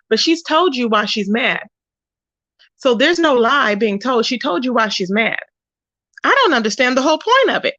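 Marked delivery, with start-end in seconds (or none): none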